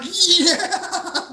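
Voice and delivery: high, loud laughing yeah; mock gleeful cheer. Pranksters watching the tape rip, gleeful laughing cheer. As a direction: cheer it through a cackle.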